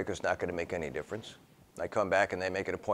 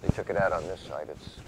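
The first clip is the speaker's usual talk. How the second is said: ordinary talk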